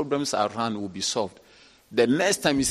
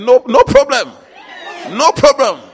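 preacher's proclamation; 10 semitones higher, 17 dB louder than usual. Retold or imitated. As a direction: proclaim it over the weeping member. Pastor voiced consoling a sinner.

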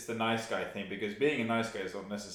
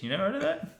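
ordinary talk, nothing marked

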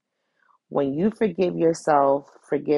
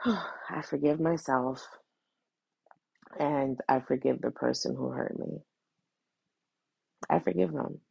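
private forgiveness; 6 dB quieter than usual. Shown as a demonstration. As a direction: speak it quietly to yourself. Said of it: "Or you could do it in private".

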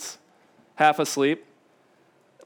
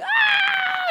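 high wailing shriek; child's scream. Retold or imitated. Father imitating his toddler's scream after a spanking. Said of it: "she's like".

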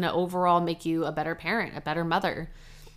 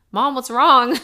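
son's worried question; 7 semitones higher, 11 dB louder than usual. Retold or imitated. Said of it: "he was like".

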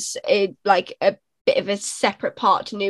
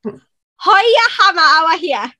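high-pitched, rapid-fire Geordie phrase; Geordie accent; 6 semitones higher, 3 dB louder, slower than usual. Shown as a demonstration. Performing the hammer line on request in Geordie accent. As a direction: show off broad Geordie, starting high and loud. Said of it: "do the one about the hammer".

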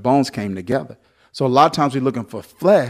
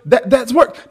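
preaching; 10 semitones higher than usual. Acted. Pastor preaching full force to the church, shouting. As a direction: preach it hard to the congregation.

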